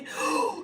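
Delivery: sharp, audible gasp of shock; quick acted gasp; startled gasp. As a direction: gasp as if caught by sudden light. Startled at the sudden light.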